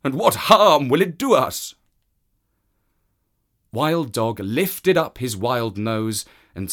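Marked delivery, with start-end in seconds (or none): 0.0-1.7 high, loud beast voice; animal voice. Acted. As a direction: voice the wild animal. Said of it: "and said".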